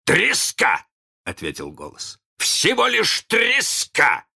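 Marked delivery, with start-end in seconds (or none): 0.0-0.9 high, loud jeer on Треска; taunting, raised exclamation; mocking shout. A narrator's reading. Mocking voice insults Hook. 2.4-4.3 very high, drawn-out, loud taunt; gleeful loud insult; mocking shout. A narrator's reading. Mocking voice insults Hook louder.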